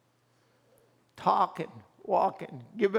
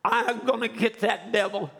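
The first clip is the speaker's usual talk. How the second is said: worked-up anger; 6 semitones higher than usual. Retold or imitated. Preacher vowing to get that devil, angry outburst. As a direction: start slow and shouting, then settle into grim resolve. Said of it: "and I was saying".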